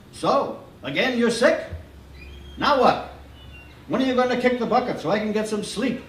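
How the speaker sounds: high, quick, gruff doctor voice; fast funny doctor. A narrator's reading. Grumpy doctor scolding the sick boy, fast and high. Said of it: "talks fast and funny".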